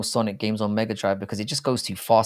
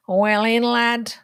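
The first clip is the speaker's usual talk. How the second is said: put-on northern lad accent; 13 semitones higher, 5 dB louder, much slower than usual. Mock northern lad cheer in accent chat.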